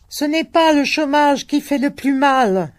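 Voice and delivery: high, loud exclamation; old man exclaims in bitter protest; exclaiming old man. A narrator's reading. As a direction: cry it out in protest. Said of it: "s'écria-t-il".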